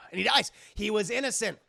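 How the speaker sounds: ordinary talk, nothing marked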